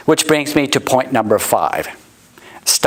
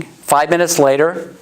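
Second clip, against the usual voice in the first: ordinary delivery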